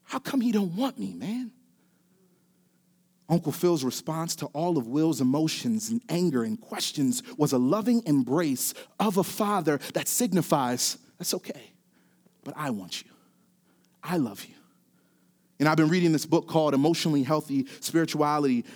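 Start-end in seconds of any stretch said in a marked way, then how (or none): 0.0-1.5 softer, breaking plea, lightly acted; tearful question. Will asking Uncle Phil why his father left, tearful. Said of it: "Will begins to cry". 11.2-14.5 much softer, lower and gentle; tender reassurance. Acted. Father embracing a crying son, hushed and tender.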